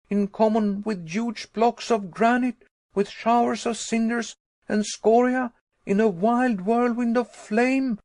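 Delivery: high, loud, rushing outrage; exasperated tirade. Acted. Nephew railing at uncle about the eruption, high and exasperated. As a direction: pile up the horrors in fury. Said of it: "in the height of my exasperation".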